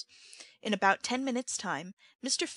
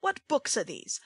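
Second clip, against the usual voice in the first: menacing question; 5 semitones higher than usual. A narrator's reading. Sikes seizes Oliver's books.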